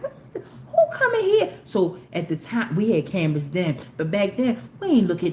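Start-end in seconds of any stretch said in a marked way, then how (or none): 0.0-1.5 high squeaky disbelief breaking into laughter; shocked laughing disbelief. Retold or imitated. Mom retelling her shock at the stranger, high laughing squeal. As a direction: laugh out a startled who-is-this.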